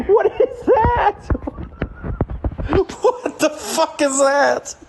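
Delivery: high, laughing what is that; laughing disbelief. Real, not acted. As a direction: crack up in shock. Shocked laugh at screen.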